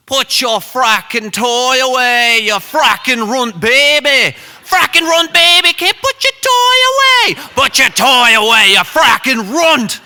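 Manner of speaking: loud, high Irish tirade; hammed-up shouting Irishman; Irish accent. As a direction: rage at full volume in a put-on Irish accent. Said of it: "I apologize in advance to any Irish, Scottish, English people for this accent".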